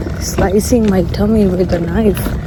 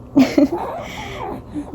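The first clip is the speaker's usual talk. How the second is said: soft giggle; 12 semitones higher, 8 dB quieter than usual. Real, not acted. Laughing about her bunny baby.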